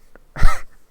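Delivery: sudden loud laugh; burst of laughter. Real, not acted. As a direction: break out laughing. Laughing at own joke.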